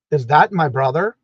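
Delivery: ordinary talk, nothing marked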